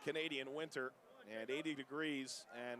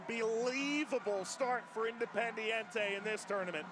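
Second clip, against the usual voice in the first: higher and louder, the last line slow; thrilled commentary; 8 semitones higher, 6 dB louder than usual. Real, not acted. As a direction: still buzzing from the goal. Still thrilled right after the goal.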